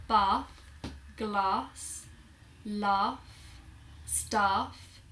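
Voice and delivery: "Bath", "glass", "laugh", "staff" all with long "ah"; southern British broad A in "Bath", "glass", "laugh", "staff"; long AH vowel. Shown as a demonstration. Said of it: "the way that I pronounce words".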